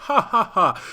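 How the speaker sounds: hammed-up villain cackle; maniacal laugh. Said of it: "that's my maniacal laugh".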